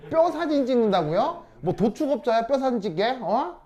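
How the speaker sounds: loud, high outraged disbelief; incredulous scolding. Real, not acted. Teacher challenging student's silly X-ray answer, incredulous. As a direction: fire back indignant questions, pitch leaping up.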